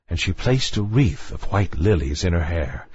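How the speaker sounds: plain reading voice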